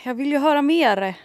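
high, loud mock-whiny begging; playful pleading. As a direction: beg like a disappointed listener, hammed-up.

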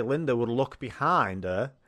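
usual speaking voice

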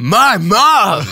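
loud, high, swooping catchphrase shout; Muscle Man. Acted. Punchline thrown back at the co-host's insult, shouted character voice. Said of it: "say it in his voice".

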